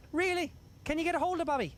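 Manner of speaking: high voice for the Irish host, surprised; lightly voiced Irish press host; imitating the Irishman. Mimicking the Irish interviewer on the Zoom call, high pitched. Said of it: "the Irishman goes".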